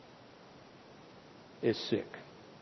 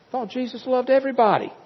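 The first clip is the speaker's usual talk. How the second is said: mock naive; 10 semitones higher than usual.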